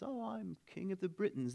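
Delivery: high, soft comic English king; mock English accent. King introduces himself to peasants, mock English accent. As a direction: announce yourself as king in a badly faked English accent. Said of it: "the king comes along and says".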